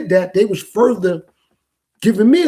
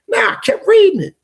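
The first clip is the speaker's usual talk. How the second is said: emphatic insistence; 13 semitones higher, 5 dB louder than usual. Real, not acted. Insisting he kept on reading.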